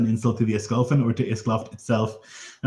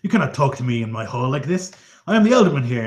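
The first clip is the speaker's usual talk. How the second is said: higher, louder indignant outburst; indignant Elderman; 3 semitones higher, 4 dB louder than usual. Acted. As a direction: insist on your authority in your hall. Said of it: "he's like".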